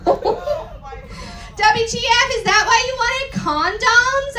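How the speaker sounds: high, slow, laughing mock-sadistic ask; asked sadistically. Reading the fanfic aloud, a high, slow mock-sadistic ask. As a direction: drag it out with cruel glee. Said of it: "I asked sadistically".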